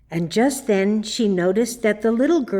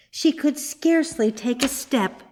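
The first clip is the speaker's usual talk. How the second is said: ordinary delivery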